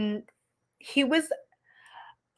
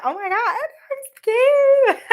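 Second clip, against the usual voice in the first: much higher, louder squeal with a laugh; mock scared; 13 semitones higher, 5 dB louder than usual. Retelling the sassy substitute, a laughing mock fright. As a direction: play terrified for laughs.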